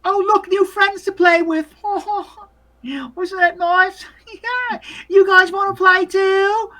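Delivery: put-on very high child voice with giggles; high, slow, giggly boy voice; delighted little boy. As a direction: greet new playmates like an excited kid. GM voices boy greeting the crows.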